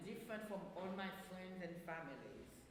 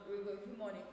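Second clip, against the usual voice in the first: usual speaking voice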